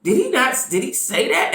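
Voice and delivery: "What?" shoots way up, then stunned; stunned disbelief. Retold or imitated. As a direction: retell your own shock, high. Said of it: "I was like".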